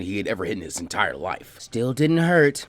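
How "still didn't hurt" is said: slow, drawn-out mocking taunt; louder, teasing slow jab. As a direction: rub it in slowly. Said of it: "Fu taunted".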